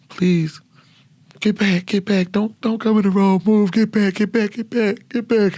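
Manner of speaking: frightened pleading. Retold or imitated. Soldier in the convoy begging the kids to stay back, frightened. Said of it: "And I'm crying and shit".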